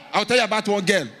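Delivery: ordinary talk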